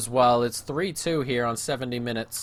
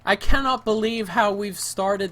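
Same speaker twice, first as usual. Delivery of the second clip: higher, louder laughing disbelief; exasperated laughing; 8 semitones higher, 7 dB louder than usual. Real, not acted. Gamer laughing at his awful season, exasperated laugh. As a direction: laugh through the frustration.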